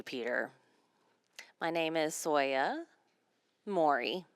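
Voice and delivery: ordinary delivery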